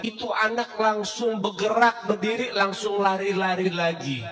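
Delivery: slow, drawn-out for wonder; slow emphatic retelling. Shown as a demonstration. Preacher repeats the miracle line slowly to the congregation. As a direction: stretch it out slowly. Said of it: "repeats the line slowly".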